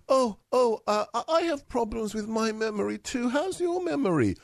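mock sympathetic questioner. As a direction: lightly mimic an over-gentle interviewer. Mimics the gentle questioner.

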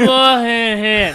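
high attempt at a Cancun accent that lands as drunk American; badly faked Cancun accent, slurring into drunk American. Co-host takes up a dare to do Cancun, bad accent. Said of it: "let me do my best Cancun accent".

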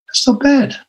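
everyone approving. Retold or imitated. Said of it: "And everyone said".

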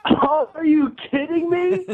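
high, slow, laughing disbelief; reeling from the prank reveal; stunned disbelief. Real, not acted. Learns it is a prank.

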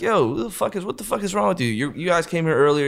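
high, heated yell of a riled-up bouncer; angry bouncer. Retold or imitated.

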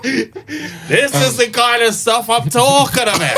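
chuckling through the line; laughing while talking. Real, not acted. Laughing along with the podcast guests while joking.

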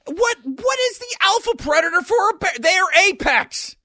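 high, loud disbelieving question; can't believe anything hunts a bear; incredulous. Real, not acted. Asking his cohost what could possibly prey on a bear, incredulous.

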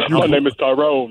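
lightly put-on man's voice; a hint of a dude, amused. Shown as a demonstration. Woman asked to prove her man voice, dude voice. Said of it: "do, do the dude voice".

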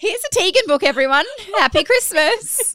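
hammed-up gift announcement; mock presentation. Mocking friend's self-centred photo book.

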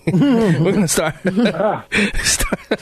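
laughing through the words; cracking up mid-sentence. Real, not acted. Laughing over his flub.

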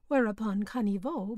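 ordinary delivery, nothing marked

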